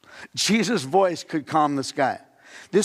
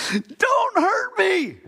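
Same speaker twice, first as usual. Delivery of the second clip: put-on very high, slow child's whimper; scared child; much slower than usual. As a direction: plead like a kid dreading the needle. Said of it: "they gotta get a shot".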